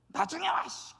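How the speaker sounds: high, irritated mock dismissal; impatient brush-off. Acting impatient fast walker. (짜증 내며 쏘아붙이듯)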